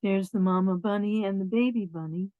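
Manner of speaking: ordinary delivery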